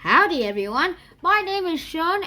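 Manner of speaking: a light put-on Texan accent. Student greets the class in a mild Texan accent. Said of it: "dropping the Texan accent from now".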